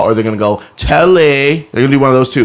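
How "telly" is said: higher exaggerated American twang; foreigner's take on an American accent; mock American accent. Foreigners imitating Americans, exaggerated American accent. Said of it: "That's the American accent".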